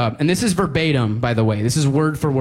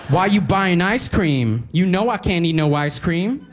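put-on higher, whiny girl's voice; 5 semitones higher than usual. Cashier girl scolding customer, higher and whiny. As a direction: complain like a sulky teen girl. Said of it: "looks up at me angrily and goes".

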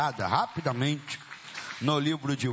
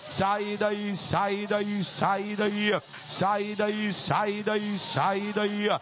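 insistent chanted commands to a demon; relentless deliverance preacher's chant; exorcism preaching; 7 semitones higher, slower than usual. Real, not acted. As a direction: drive it out in rhythmic repeats, stretching the last. Casts out a demon, repeated commands.

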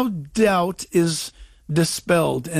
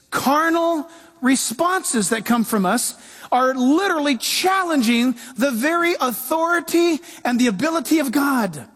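ordinary delivery

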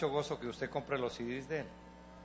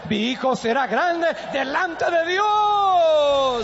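higher, louder, very slow proclamation; shouted declaration; 12 semitones higher, 11 dB louder, much slower than usual. Shown as a demonstration. Pastor models the confession loudly for parents. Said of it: "¿Cuántos lo confiesan?".

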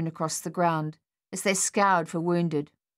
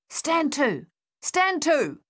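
higher, loud order down the line; calling out; 10 semitones higher, 3 dB louder, slower than usual. A narrator's reading. Captain calling his men along the trench, loud. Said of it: "he called along the line".

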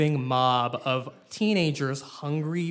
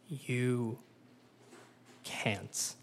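sly knowing Sarah; 6 semitones lower, 10 dB quieter than usual. Acted. Sarah's sly low reply.

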